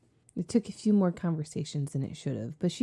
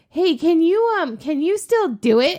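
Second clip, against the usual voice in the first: eager coworkers; 12 semitones higher, 8 dB louder than usual. Retold or imitated.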